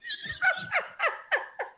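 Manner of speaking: squealing high laugh. Real, not acted. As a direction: break into a high cackle. Bursts out laughing.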